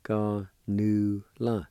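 Ganula slowly, syllable by syllable; slow pronunciation demo. Shown as a demonstration. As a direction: stretch out each syllable of Ganula. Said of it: "Second one".

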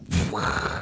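imitated rushing wildcat pounce; pounce noise. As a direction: make a rough whooshing attack sound.

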